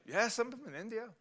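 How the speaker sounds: lifts his voice high to play the man from India; a light high imitation of the man; high mock voice of the Indian man. Preacher imitates the man he witnessed to, high-voiced.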